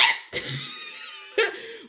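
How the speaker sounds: high, cracking laugh of disbelief; disbelieving laugh. Real, not acted. Reacting to the doctor's eight-day fever, laughing in disbelief. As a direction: burst into an incredulous laugh.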